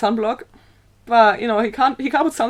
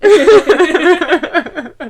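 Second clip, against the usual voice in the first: laughing; 7 semitones higher, 7 dB louder, much slower than usual. Real, not acted. Laughing at the joke.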